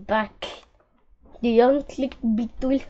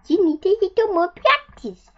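squeaky mouse voice; 9 semitones higher, 3 dB louder than usual. A narrator's reading. Mouse speaks.

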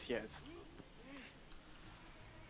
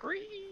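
high-pitched mock squeal; mimicking a tantrum screech; imitated squeal; 4 dB quieter than usual. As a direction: let out a very high, drawn-out "ree". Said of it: "He's like".

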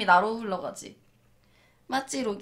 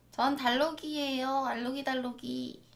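Dallok; 6 dB quieter than usual. Shown as a demonstration. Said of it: "해봐, 달록이 자기".

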